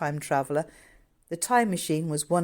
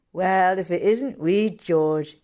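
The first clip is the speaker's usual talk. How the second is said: loud, slow delighted greeting; a big hearty hello to the boy; exclaiming; 7 dB louder, slower than usual. A narrator's reading. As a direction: keeper greets little George loudly and fondly. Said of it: "exclaimed Harry".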